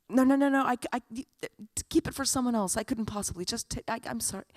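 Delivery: high-pitched, stammering protest; flustered polite refusal. Retold or imitated. Girls refusing the flower as a favor, flustered and apologetic.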